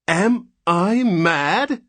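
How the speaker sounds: slow, high and loud, thundering; thundered. Acted. Father to nurse at the crib, thundering in rage. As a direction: let terror burst into a roar. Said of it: "thundered Mister Button".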